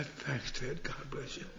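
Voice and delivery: humble thanks. Retold or imitated. Grateful beggar thanking Ted.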